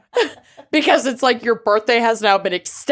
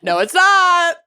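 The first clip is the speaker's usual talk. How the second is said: playful shout; 9 semitones higher than usual. Real, not acted. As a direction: shout it down, laughing. Playful protest after "show is over.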